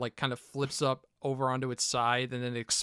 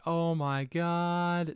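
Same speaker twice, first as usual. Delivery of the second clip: slow, higher imitation of a movie scream; drawn-out mock scream; 5 semitones higher, much slower than usual. Host imitating the screaming dude from the clip, drawn-out mock scream. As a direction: stretch it out long and higher, without volume. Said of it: "dude just screaming".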